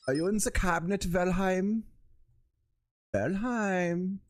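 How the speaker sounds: put-on high German-accented calling, rising ends; movie German accent. Papa checking the cabinet for Valheim, high mock German accent. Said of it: "movie version of a, of a German accent".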